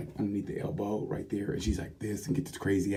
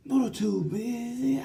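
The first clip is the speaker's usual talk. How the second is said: a goofy high-pitched repeat; squeaky high hype; much slower than usual. Real, not acted. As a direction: jump way up in pitch, playfully.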